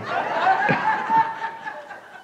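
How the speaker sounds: laughing at the memory of the vanished stranger; high burst of laughter. Real, not acted.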